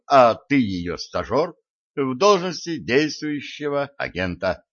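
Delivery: usual speaking voice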